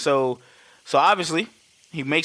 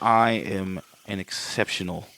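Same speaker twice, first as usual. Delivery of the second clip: put-on low, slow villain in Hans's accent; measured, deep-voiced attempt at Hans's accent; 6 semitones lower than usual. Hans answering Holly's insult, low accented impression. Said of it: "I lost the fucking accent".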